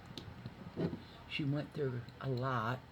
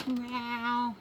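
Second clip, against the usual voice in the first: high meow; mock meow; 11 semitones higher, 5 dB louder than usual. Speaker imitates cat.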